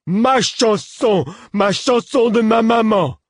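high, loud, slow wailing yell; childlike shout. A narrator's reading. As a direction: scream it, outraged and possessive. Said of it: "hurla-t-il". (cri aigu et lent d'enfant)

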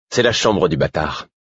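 understated cold defiance; icy challenge. A narrator's reading. Servant challenging the man about his room, coolly defiant. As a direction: state it as a cool challenge. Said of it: "Il y avait un défi glacé dans sa voix".

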